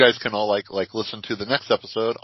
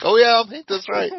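much higher and louder hammed-up yes; mock eager reply; 14 semitones higher, 7 dB louder than usual. Replying to his friend, hammed-up high eager voice. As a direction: play it up like an overeager yes-man. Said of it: "And I'm like".

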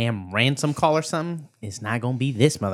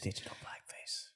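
hushed whispered echo; whispering; 24 dB quieter than usual. Real, not acted. Friend echoing the phrase to himself, whispering. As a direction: repeat it under your breath. Echoing others under the breath.

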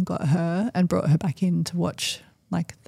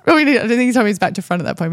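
ordinary delivery

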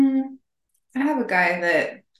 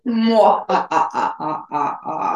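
villain laugh, mwah-ha-ha; evil chuckle; 4 semitones lower, slower than usual. Pretend. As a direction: give a movie villain cackle. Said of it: "Try one".

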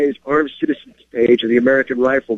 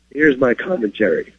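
ordinary talk, nothing marked

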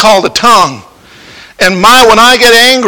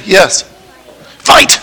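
usual speaking voice

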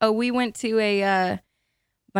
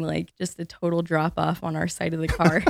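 normal talking voice

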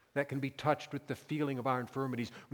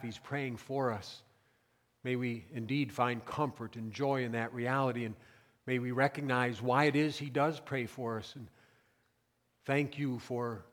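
prayer; 4 semitones lower than usual. Acted. Pastor leading the congregation in prayer.

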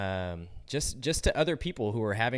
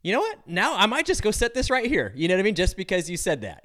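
much higher, louder defiant retort; defiant comeback; 9 semitones higher, 7 dB louder than usual. Acted. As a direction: push back loudly out of spite, pitched way up. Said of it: "You'd be like".

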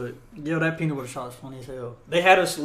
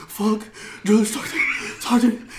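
gasping, higher broken-up words; mock winded recruit; 5 semitones higher, 3 dB louder than usual. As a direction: imitate a recruit gasping for air. Recruit returns winded from punishment.

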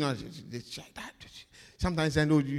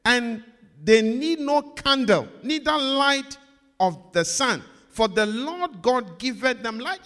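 solemn reading, building louder; scripture reading; 5 semitones higher, 5 dB louder than usual. Acted. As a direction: read the verse with rising weight. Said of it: "let's read the part in bold".

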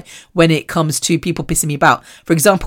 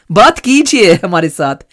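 coaxing in Hindi; slower than usual. Retold or imitated. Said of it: "And then I was like".

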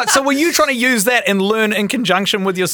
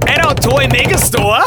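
hyped announcement; 6 semitones higher, 3 dB louder than usual. Acted. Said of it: "do you wanna do your drum roll again".

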